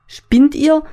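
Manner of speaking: high, loud outraged shout. Acted. Newborn yells at the people grabbing her, loud shout. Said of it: "will ich schreien".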